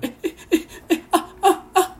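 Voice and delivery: high panicked stammering yelps; flustered stammer. Retold or imitated. 女性に挨拶され動揺した自分がどもる. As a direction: stammer like a startled puppy. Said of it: "子犬が鳴くような声".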